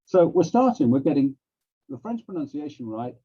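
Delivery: ordinary talk, nothing marked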